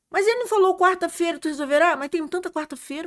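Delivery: high-pitched retold back-and-forth; animated arguing. Sisters debate which Wednesday was meant, animated arguing.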